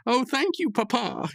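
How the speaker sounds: very high, sweet put-on voice; Pops voice. Pops thanking his father Maylard, high sweet voice. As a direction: gush thanks like a grateful son.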